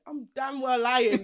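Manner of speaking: loud, slow, laughing through the words; laughing confession. Real, not acted. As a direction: laugh at your own lie.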